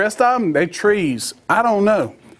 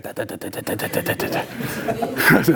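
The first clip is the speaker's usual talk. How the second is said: rapid low sputtering tuh-tuh-tuh; stuttering motor noise; imitated idling chainsaw; 3 semitones lower, 5 dB quieter than usual. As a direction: mimic an engine idling on the ground. Imitates saw rattling on ground.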